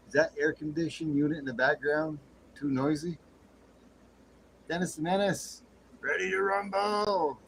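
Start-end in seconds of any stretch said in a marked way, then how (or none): 4.7-7.4 announcer hype. Pretend. Streamer introducing Dennis the Menace, mock ring announcer call. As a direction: boom it out like a boxing announcer.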